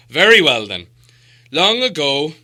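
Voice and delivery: put-on as the dwarf leader beginning an old tale; solemn Thorin. A narrator's reading.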